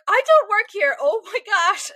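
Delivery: high, loud flustered outburst; mock panic. Realizing she isn't staff, loud flustered outburst. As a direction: blurt it out in embarrassed panic. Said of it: "I immediately was like".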